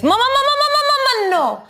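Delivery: very high, rapid-fire "mama" plea; imitating toddler son. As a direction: squeal like a frantic little kid cutting off a song. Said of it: "and he goes".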